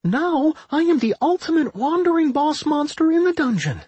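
put-on very high, grand slime-girl brag; high-pitched, triumphant boasting; boastful. A narrator's reading. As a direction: crow about your rank in a high voice. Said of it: "Anodyne boasted".

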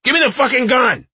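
furious yell, high and loud; shouting. Acted. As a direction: scream the demand. Husband demands gun, shouting.

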